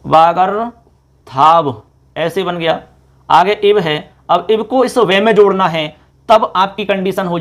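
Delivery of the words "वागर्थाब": वागर्थाब very slowly, broken before थाब; sandhi word demonstration. Shown as a demonstration. Teacher shows how it will form, slow segmented pronunciation. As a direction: hold वागर, pause, then a long थाब. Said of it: "ऐसे बनेगा".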